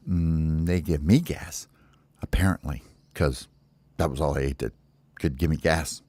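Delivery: low, soft, halting admission; sheepish mumbling. Acted. Baby bird explains his gas to the counselor, low and sheepish. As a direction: own up quietly, a bit embarrassed.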